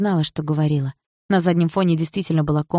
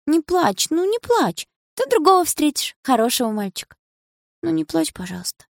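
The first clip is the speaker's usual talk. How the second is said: little sister comforting her crying sister, high and tender; comforting child; 10 semitones higher, 4 dB louder than usual. Acted. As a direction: soothe her big sister.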